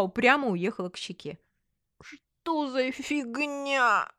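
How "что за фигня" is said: high, very slow slurred whine; drawn-out tearful complaint, mildly hammed-up; whimpering. A narrator's reading. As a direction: wail it out through a numb lip. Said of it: "всхлипнула подруга".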